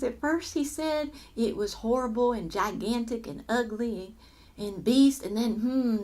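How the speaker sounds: plain reading voice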